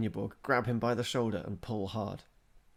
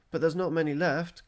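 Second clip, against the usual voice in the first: complaining; 8 semitones higher, 9 dB louder than usual. A narrator's reading. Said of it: "complained Bjorn".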